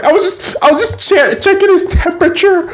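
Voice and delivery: very high, stammering mock alarm; squeaky put-on fluster; panicked excuse. Girl makes a panicked excuse.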